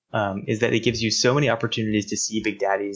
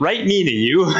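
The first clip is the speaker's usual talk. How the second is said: put-on high, loud gee-whiz kid voice, laughing; wholesome 1950s kid; 8 semitones higher, 5 dB louder than usual. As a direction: ham up an old-timey polite boy. Mocking an innocent game character.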